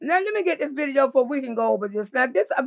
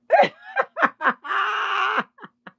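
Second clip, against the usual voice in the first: laughing; 6 semitones higher than usual. Real, not acted. Laughs at sexy sign.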